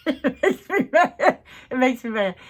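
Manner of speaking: laughing, high and loud exasperation; half-laughing mock fury; laughing mad. Real, not acted. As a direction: laugh through real annoyance. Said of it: "you can tell I'm mad".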